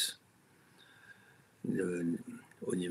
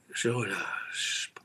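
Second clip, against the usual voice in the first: slow release; much slower than usual. Shown as a demonstration. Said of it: "quatre, cinq".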